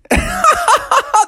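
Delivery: very high cackle at someone; mocking laugh. A narrator's reading. Beavers laugh at Billy's question, mocking laugh. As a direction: laugh at him in a high pitch. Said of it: "They laughed at him".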